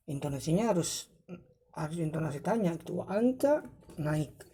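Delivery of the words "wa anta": "Wa anta?" climbing high at the end; rising question intonation. Shown as a demonstration. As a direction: demonstrate "Wa anta?" with anta swept upward. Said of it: "intonasi naik". ("Wa anta?" intonasi naik seperti bertanya)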